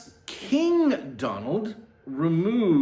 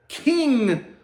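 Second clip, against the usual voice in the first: crowd's outrage; 10 semitones higher, 7 dB louder than usual. A narrator's reading. Mock outrage at crowd verdict.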